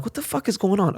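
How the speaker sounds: baffled at the boarded-up street, high and incredulous; baffled disbelief. Retold or imitated. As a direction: voice jumps up in disbelief. Shops closed, baffled on Sunset.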